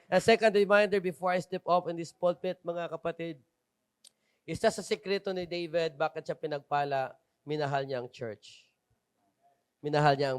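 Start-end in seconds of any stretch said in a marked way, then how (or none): none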